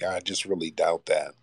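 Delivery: whispered, half to himself; whispered aside. Real, not acted. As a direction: mutter the doubt under your breath as an aside. Repeats doubt, then whispers it.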